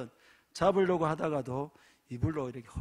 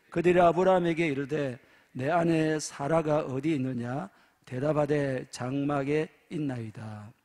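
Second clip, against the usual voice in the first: louder, declaiming Bible reading; scripture reading; 4 dB louder than usual. Acted. Preacher reading the verse to the congregation, declaiming.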